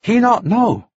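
high halting interpreter; Arsuk, translating. A narrator's reading. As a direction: answer simply in a high character voice.

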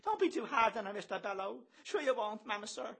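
put-on high, falsetto girlish plea; pleading Kitty. A narrator's reading. Kitty begs Bello for leniency, pleading high.

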